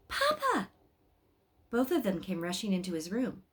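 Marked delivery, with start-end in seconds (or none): 0.1-0.7 a high calling shout for Papa. A narrator's reading. Boy calling his father at night, high shout. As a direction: call out high, as if for help. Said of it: "he shouted".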